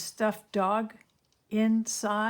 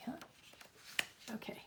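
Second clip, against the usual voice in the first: ordinary delivery, nothing marked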